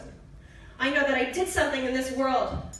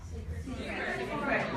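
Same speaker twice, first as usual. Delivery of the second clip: quiet; 6 dB quieter than usual. Shown as a demonstration. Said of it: "Come on".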